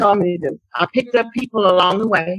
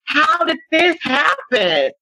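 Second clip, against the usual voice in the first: slow, higher, half-shouted disbelief; shocked outcry; 7 semitones higher, much slower than usual. Retold or imitated. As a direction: stretch it out like you can't believe your eyes. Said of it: "I'm like".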